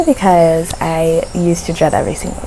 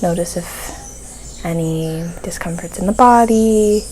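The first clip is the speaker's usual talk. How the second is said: guided meditation; 5 dB quieter than usual. Real, not acted. Host guiding listeners to soften their focus, guided meditation. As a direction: ease listeners gently into calm, phrase by phrase.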